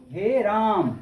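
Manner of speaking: हे राम with both words drawn out long; prolonged call. Shown as a demonstration. Teacher demonstrating the long vowel to class, drawn out. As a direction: stretch हे and राम over three beats. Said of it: "तीन मात्रा का समय लगता है".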